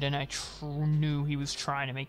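low, hushed, brooding read; mock-grave soft narrator; dramatic narration. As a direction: murmur it like a dark revelation. Reads document as solemn narration.

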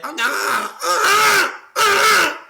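put-on loud, high baby-like wail; mock baby imitation. Streamer mocking girls who imitate babies, wailing baby voice. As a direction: mimic baby talk, rising to a shrieked wail. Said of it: "bebek taklidi yapan kızlardan".

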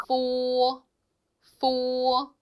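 포 with lips brushing for an f; Chinese fo syllable. Shown as a demonstration. Teacher models the Chinese f for students. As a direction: model 포 slowly. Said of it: "포 이렇게 합니다".

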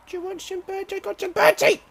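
high sing-song coaxing call; calling to birds. Pretend. Survival gamer calling birds in a high coaxing voice.

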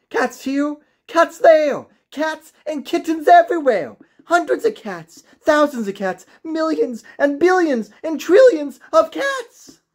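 high, loud, building list of cats; overwhelmed outcry. A narrator's reading. Old woman listing the cats to her husband, high and rising. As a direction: pile it up in mounting disbelief. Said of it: "she cried".

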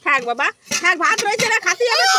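climbs to a high, loud shout over the crying; coaxing that turns into a shout; rising shout. Real, not acted. Mother shouts over her wailing son to leave the rice. Struggling to pull crying child away.

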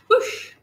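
loud airy whoosh; big rush of wind; whoosh effect. A narrator's reading. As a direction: whoosh away like a broomstick. Narrator voices broomstick taking off.

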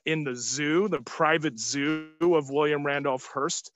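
ordinary talk

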